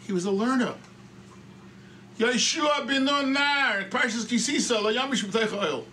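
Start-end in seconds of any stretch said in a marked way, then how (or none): 2.2-5.9 high, slow, drawn-out citation; proclaimed verse. Shown as a demonstration. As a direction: call the verse out, stretching NAR. Verse proclaimed before questioning it.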